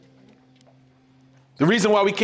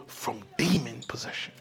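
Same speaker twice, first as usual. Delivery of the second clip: hushed, breathy whisper, much softer; 8 semitones lower, 12 dB quieter than usual. Acted. Preacher to congregation, a sudden hushed whisper. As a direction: drop it to a secret whisper.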